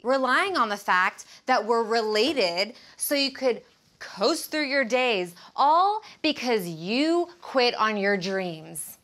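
angry, emphatic, drawn-out key words; fed-up tirade. Acted. Telling off the freeloading relative in her apartment, fed up. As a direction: lay into them, stretching the accusations.

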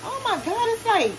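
excited high squeal; amazed. Real, not acted. Amazed by soft bread.

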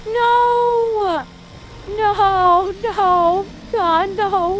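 anguished cries of no, rising high and loud; begging the game not to let him die; despairing shouts. Real, not acted. Streamer watching his run fall apart, crying out in despair. Boss fight going wrong, despairing.

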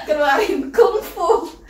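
laughing high and drawn out. Real, not acted. Joking to viewers while opening the dough can, laughing. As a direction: say it through giggles, high-pitched.

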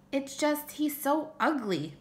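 put-on high, slow childish complaint; child pleading his case to mom; little boy protesting. Small boy protesting to his mother.

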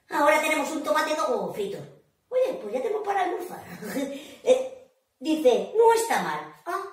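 ordinary delivery

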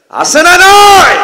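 very loud, high, slow demand; drawn-out shout. Real, not acted. Preacher demands an answer from the crowd. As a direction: stretch it into a roar.

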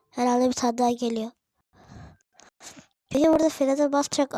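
ordinary delivery

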